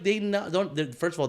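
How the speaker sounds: ordinary talk, nothing marked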